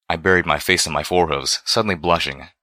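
ordinary delivery, nothing marked

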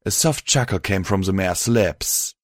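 ordinary delivery

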